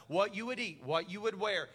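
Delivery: ordinary talk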